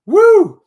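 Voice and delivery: high, loud whoop; joyful high woo; excited woo. Real, not acted. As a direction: cheer it out big. Excited cheer on livestream.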